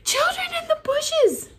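very high frightened squeak. A narrator's reading. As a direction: shriek it up high in panic. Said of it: "squeaked Pog".

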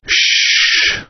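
unvoiced shush; hush. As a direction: silence them sharply. Hushing someone.

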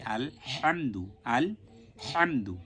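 slow আল হামদু with a strong pharyngeal h; careful Arabic হামদু, rasping breath on the h; mid-throat h. Shown as a demonstration. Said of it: "তাহলে কিন্তু বিরাট ভুল হয়ে যাবে".

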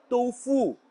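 « tofu » avec ou, « toufou », lentement; tofu à la chinoise. Shown as a demonstration. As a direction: dites tofu avec un son ou. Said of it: "la prononciation de tofu". (tofu prononcé « toufou »)

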